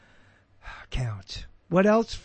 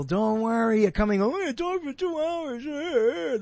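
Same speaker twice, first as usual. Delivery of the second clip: mock quote of them; 7 semitones higher than usual. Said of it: "That's the way they talk".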